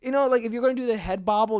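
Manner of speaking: normal talking voice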